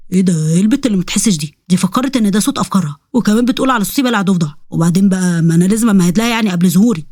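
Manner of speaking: low demon voice, baffled and scheming; low-pitched plotting demon; annoyed demon. Acted.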